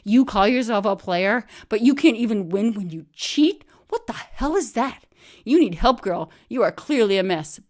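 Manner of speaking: loud scornful scolding; loud scornful rant at a cheating friend; berating. Retold or imitated. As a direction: tear into her, loud and contemptuous. Berating Bean loudly.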